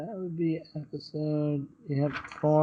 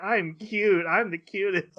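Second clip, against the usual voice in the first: vain character; 7 semitones higher, slower than usual. Retold or imitated. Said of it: "so many characters like".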